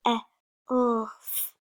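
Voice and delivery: "elf" built up sound by sound. Shown as a demonstration. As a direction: separate the sounds of "elf" slowly. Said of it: "you can sound these out".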